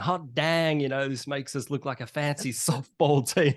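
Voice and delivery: put-on New York accent. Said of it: "Ezra in his New York accent".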